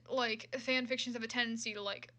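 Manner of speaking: ordinary delivery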